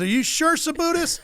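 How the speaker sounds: high questioning impression of a guest; John Force. Guest checks with owner before tossing lamp, high questioning. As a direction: imitate him checking, pitch climbing high. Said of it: "He goes".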